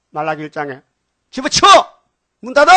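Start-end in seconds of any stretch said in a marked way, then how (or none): 1.3-2.7 furious shout. Acted. God rejecting offerings in Malachi.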